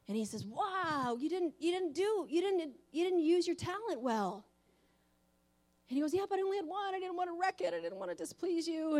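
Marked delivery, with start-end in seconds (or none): 0.5-4.5 higher, louder, scolding boss voice; hammed-up scolding boss; mock angry boss. The boss scolding the servant who buried his talent, mock angry. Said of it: "He was really mad". 6.1-9.0 higher, pleading servant voice; sincere servant. Retold or imitated. Servant explaining he only had one talent, mock sincere plea. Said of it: "he sounded really sincere".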